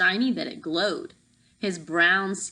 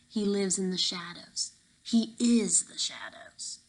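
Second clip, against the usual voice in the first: ominous whisper. Acted. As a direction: make the shadows sound alive. Guard warns about hidden man.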